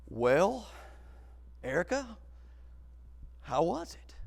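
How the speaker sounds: soft, halting, with long pauses; tentative question. Retold or imitated. Man checking on the girl after the ride, tentative. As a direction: ask it gingerly, word by word.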